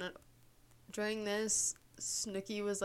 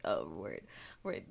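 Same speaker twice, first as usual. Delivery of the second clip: much lower, clipped bro voice; deep mock bro; 12 semitones lower, 6 dB quieter than usual. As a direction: drop way down like a chill dude. Boyfriend backs down.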